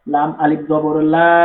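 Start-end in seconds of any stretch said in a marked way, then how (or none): 1.1-1.3 লা with the aa drawn out for one alif; long vowel. Shown as a demonstration. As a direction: hold the aa in লা. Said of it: "এক আলিফ টান দিয়ে পড়তে হবে".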